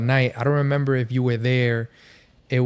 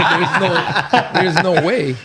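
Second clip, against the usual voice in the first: laughing; 5 semitones higher, 4 dB louder, slower than usual. Real, not acted. Still laughing at his own story.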